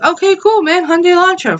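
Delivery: high-pitched, eager retelling of saying yes; mock excited. Retold agreeing to buy car.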